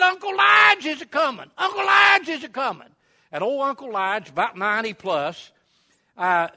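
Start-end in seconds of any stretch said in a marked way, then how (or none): 0.0-2.9 high, loud yelled warning at the top of the lungs; frantic warning holler. Retold or imitated. Ezra warning everyone at the store, loud high shout. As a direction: yell it to clear everyone out of the road.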